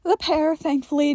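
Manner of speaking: ordinary delivery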